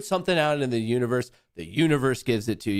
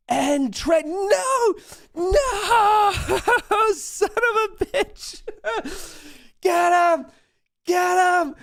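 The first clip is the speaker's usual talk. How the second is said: high, loud, laughing shrieks of triumph; ecstatic screaming; 6 dB louder, slower than usual. Real, not acted. Streamer yelling as his trade wins against Trex, ecstatic screaming.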